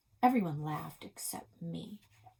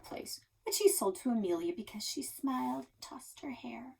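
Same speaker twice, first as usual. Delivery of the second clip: whisper; 6 semitones higher than usual. A narrator's reading. Scornful whispered aside.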